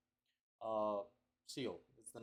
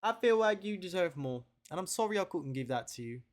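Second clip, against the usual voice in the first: a mild put-on British accent, pitched up; a light mock British lilt, as if speaking to the ex; 7 semitones higher, 7 dB louder than usual. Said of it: "me talking my British accent".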